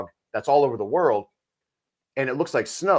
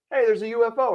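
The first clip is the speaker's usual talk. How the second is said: excited call; 10 semitones higher than usual. Retold or imitated. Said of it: "Now I can go".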